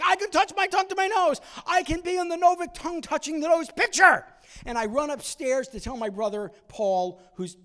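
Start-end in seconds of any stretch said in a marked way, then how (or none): 0.0-4.2 put-on high, loud, thrilled boyish shout; excited shout. Boy running through the house, shouting with excitement. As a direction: yell it like a kid who finally did it. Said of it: "I go running around the house going".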